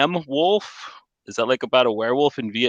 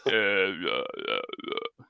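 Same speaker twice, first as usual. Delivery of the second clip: low, quiet grunts; Dolph Lundgren; 6 semitones lower, 12 dB quieter, much slower than usual. Acted. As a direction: mumble like a monosyllabic action star. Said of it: "talk like Dolph Lundgren".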